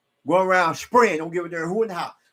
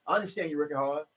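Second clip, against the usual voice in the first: voicing God; 6 semitones lower, 8 dB quieter than usual. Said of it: "God said".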